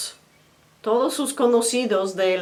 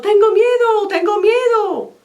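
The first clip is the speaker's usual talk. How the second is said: much higher, loud panicked shout; fearful cry; 13 semitones higher, 8 dB louder than usual. Acted. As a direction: yell it out as if terrified. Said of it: "deja claro si está diciendo".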